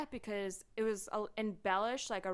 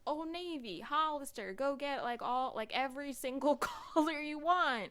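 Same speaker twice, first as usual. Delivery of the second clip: higher, chirpy sales pitch voice; mock ad voice; 6 semitones higher than usual. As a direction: sell it like a cheery store ad. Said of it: "it was like".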